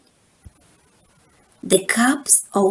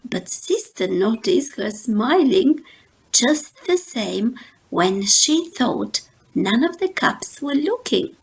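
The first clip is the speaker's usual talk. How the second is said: ordinary delivery, nothing marked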